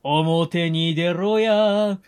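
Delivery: slow, drawled yankee thug chant; japanese delinquent tough talk. Pretend. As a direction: roll out the punk threats slowly. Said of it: "完全に音声配信ありきになってんだよ".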